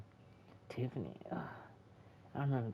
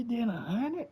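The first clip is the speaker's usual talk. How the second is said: ordinary talk, nothing marked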